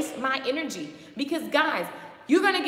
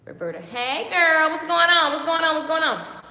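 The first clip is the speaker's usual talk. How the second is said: excited greeting; 5 semitones higher, 8 dB louder than usual. Real, not acted. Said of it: "greets live chat viewers".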